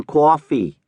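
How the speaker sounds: "coffee" stretched in a playful New York drawl; lightly hammed New York accent on "coffee"; mock New York drawl. A narrator's reading. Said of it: "her Irish accent transforms into a New York drawl".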